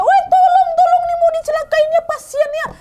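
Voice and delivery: very high, loud panicked yell; shouting for help. Retold or imitated. Midwife yelling that the patient is about to be hurt, panicked shout. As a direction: cry out urgently for rescue. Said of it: "langsung berteriak".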